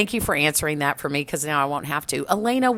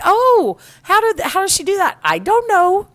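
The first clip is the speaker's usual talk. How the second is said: very high, louder mimicked voices; high, loud mock bafflement; hammed-up exchange; 13 semitones higher, 6 dB louder than usual. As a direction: act out the flustered back-and-forth, pitched way up. Acts out questioners and clueless reply.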